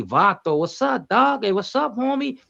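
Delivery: high playful Chicano street greeting. Retold or imitated. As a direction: pitch it up, tease like a homie from the barrio. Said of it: "they can say".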